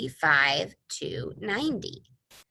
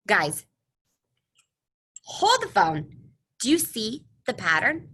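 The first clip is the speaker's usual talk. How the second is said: surprised; 4 semitones higher, 5 dB louder than usual. Acted. Teacher gasps at discovery.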